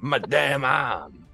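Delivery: slow, drawn-out mock pirate groan. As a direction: groan it like a pirate with a sore arm. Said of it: "the weird pirate voice".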